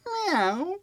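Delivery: high meow imitation; cat meow. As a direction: mew like a cat hiding in a tree. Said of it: "The second bank robber goes".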